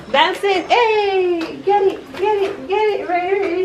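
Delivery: high, eager encouragement; excited cheering. Real, not acted. Cheering baby on to grab.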